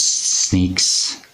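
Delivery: usual speaking voice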